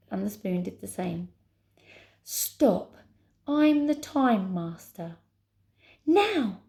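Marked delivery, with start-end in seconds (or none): 2.2-5.2 raised, drawn-out command. Acted. Tower orders them to stop. 6.0-6.6 high, loud urgent cry; shouted command. Acted. Heroine shouts while pushing.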